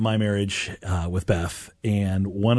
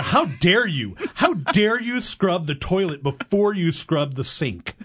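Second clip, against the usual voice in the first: mock outrage; 9 semitones higher, slower than usual. Acted indignant spouse.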